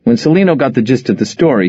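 usual speaking voice

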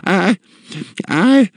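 high, slow stammering matron; aghast woman, lost for words; shocked disbelief. A narrator's reading. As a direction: falter in high-pitched disbelief. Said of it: "her big horse eyes plainly shocked".

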